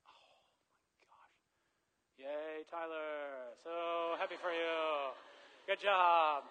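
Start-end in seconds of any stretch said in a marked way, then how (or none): 2.2-6.4 mock cheer. Mock cheer for buddy's card.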